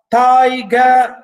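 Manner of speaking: "Tiger" loud and drawn out in two beats; reading by syllables. Shown as a demonstration. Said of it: "We read the".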